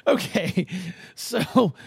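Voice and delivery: ordinary talk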